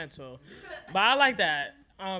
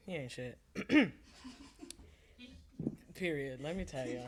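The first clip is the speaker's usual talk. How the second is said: ordinary talk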